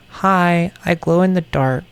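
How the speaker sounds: lightly voiced cheerful plush octopus; cute toy voice. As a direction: give the plush octopus a sweet squeak.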